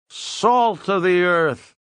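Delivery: slow, high tipsy pronouncement; drunk Weasel. A narrator's reading. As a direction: draw it out like a proud drunk finishing a toast. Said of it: "He was becoming very drunk".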